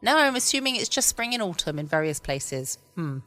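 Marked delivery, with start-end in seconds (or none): none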